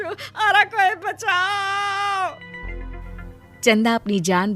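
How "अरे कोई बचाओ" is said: very high, long-drawn scream; screaming for help. Acted. Woman trapped among snakes and scorpions, screaming. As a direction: stretch out a terrified cry.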